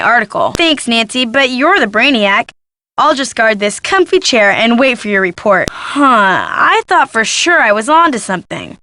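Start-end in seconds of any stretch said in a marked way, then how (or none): none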